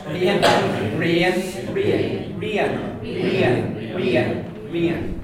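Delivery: repeated Belfast "Rain" with a two-part ree-an vowel; Belfast pronunciation. Shown as a demonstration. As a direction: say "Rain" as they do in Belfast, over and over. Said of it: "The way we say it in Belfast".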